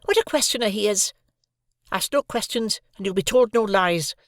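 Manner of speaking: high, sharp scolding voice; scolding sister. A narrator's reading. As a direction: scold the nosy boy.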